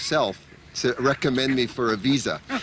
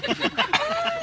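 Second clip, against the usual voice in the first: sudden high burst of laughter. Real, not acted. Laughing while quoting Swami calling her crazy. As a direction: let the laugh escape.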